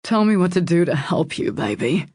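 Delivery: mildly desperate male plea; earnest appeal to help a loved one; pleading. A narrator's reading. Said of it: "Jensen's voice was pleading. He sounded desperate".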